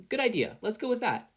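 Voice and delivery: bright eager agreement; Izuku's voice. A narrator's reading.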